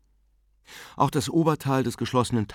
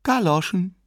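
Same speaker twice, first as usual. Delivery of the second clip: higher, louder exclamation of a story figure; amused wife, voiced higher; Mrs Conroy; 10 semitones higher, 4 dB louder than usual. A narrator's reading. Wife scoffing at her husband's newest purchase, amused.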